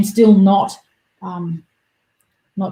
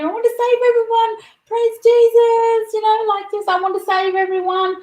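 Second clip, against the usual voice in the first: mock savior; 14 semitones higher than usual. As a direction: ham it up like a tent preacher. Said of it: "I had my Jesus moment".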